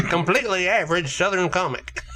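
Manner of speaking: high-pitched mock Southern comic; funny Southern voice. Podcaster mocking an average comic to co-hosts, funny Southern voice. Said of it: "a funny Southern voice".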